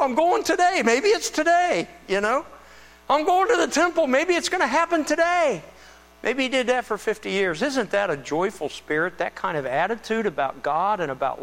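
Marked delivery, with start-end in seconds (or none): 0.0-5.6 high, loud eager voice; excited Simeon. Retold or imitated. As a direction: bubble over like an old man about to see a promise. Preacher voicing Simeon's excitement.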